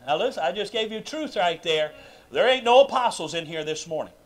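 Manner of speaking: usual speaking voice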